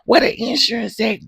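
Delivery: high whiny demand without the crying; mock whine. Early imitation of Letitia, no crying.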